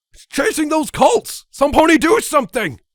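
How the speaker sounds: shrill high call for help; panicked screaming. Acted. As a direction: beg someone to act. Ponies shrieking in terror.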